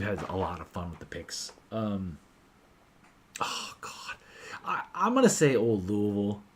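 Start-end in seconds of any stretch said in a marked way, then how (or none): 3.4-4.2 breathy whispered sigh of indecision; whispered oh God. Real, not acted. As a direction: drop to a whisper, torn between choices. Torn over choosing a favorite.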